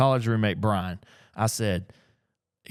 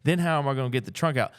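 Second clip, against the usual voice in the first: ordinary talk, nothing marked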